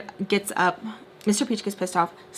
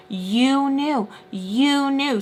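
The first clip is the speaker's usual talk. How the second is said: drawn-out, higher-pitched mock accusation; accusing; 5 semitones higher, 4 dB louder, much slower than usual. Daughter arguing back at her father, accusing.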